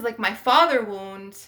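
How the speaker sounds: usual speaking voice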